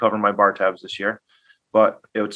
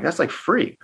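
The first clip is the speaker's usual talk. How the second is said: amazed; 8 semitones higher, slower than usual. Retold or imitated. Stunned at the low price.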